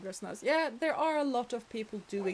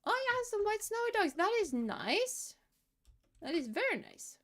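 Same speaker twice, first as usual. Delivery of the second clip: high, delighted cooing; 12 semitones higher than usual. Real, not acted. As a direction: gush over something cute.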